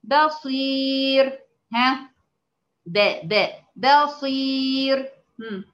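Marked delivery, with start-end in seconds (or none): 0.0-1.4 بصير stretched very slowly, thin ra, in a recitation voice; recited example word. Shown as a demonstration. Teacher reciting بصير slowly for students. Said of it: "كلمة زي كلمة". 3.8-5.1 بصير stretched very slowly, thin ra, in a recitation voice; recited example word. Shown as a demonstration. Teacher reciting بصير slowly for students. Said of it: "كلمة زي كلمة".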